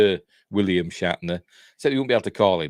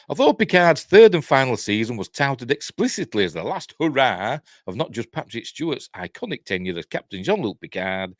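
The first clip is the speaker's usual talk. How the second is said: raised, punchy news-reading voice; article read-out; 6 semitones higher, 5 dB louder than usual. Acted. Host reading viewers the Picard story, punchy read-out. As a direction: read it out loud and lively.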